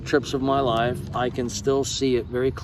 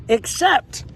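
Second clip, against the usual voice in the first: mock British accent; 10 semitones higher than usual. Shown as a demonstration. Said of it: "reading letter in put-on accent".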